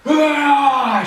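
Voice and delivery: high, loud angry cry; Thor's roar. Acted. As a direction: roar in dismay. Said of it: "shouts Thor".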